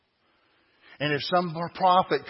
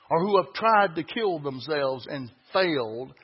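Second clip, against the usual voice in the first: ordinary talk, nothing marked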